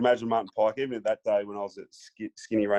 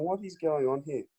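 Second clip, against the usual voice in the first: mild higher-pitched bewilderment; retold disbelief; 5 semitones higher than usual. Rookie stunned by a star's threat, retold disbelief. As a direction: replay your own baffled inner voice. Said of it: "and I'm going".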